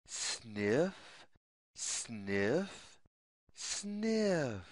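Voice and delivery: low, hushed little hare sniffs; hare sniffing. Retold or imitated. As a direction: sniff softly like a shy hare. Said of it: "makes the sound".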